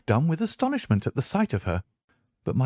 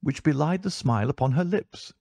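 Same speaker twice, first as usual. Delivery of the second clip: ordinary delivery, nothing marked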